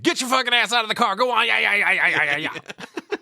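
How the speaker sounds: high, fast barked yell that fades; yelling at the kid. Retold or imitated. Clown yelling at the boy to get out of the car. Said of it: "And he yells at the kid".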